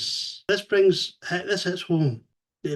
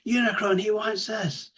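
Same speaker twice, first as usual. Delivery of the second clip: higher frantic pleading voice; frantic Starscream; 7 semitones higher than usual. Retold or imitated. Starscream warning Megatron in a chokehold, frantic. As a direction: plead urgently, pitched up.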